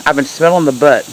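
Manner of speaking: ordinary delivery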